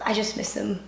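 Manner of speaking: quiet, choked up, holding back tears; missing her college friends, telling viewers tearfully. Real, not acted. Fighting tears while saying it. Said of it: "oh no, I'm getting emotional".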